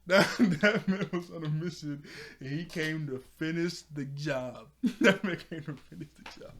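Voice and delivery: words breaking into real laughter; can't get through the line for laughing. Real, not acted. Cracking up at the scene.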